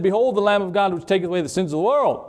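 John the Baptist proclaiming. Retold or imitated. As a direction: announce it fast and high like a prophet. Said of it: "he just said".

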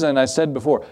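ordinary talk

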